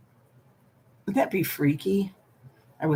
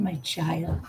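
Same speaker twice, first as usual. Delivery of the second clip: ordinary talk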